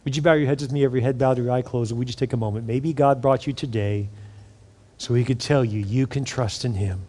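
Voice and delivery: hushed pastoral invitation; altar call prayer. Acted. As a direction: guide them gently into prayer. Leads prayer over music.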